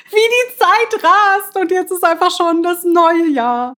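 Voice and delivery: giddy laugh through the words; delighted laugh as she marvels. Real, not acted. Excited about the new year.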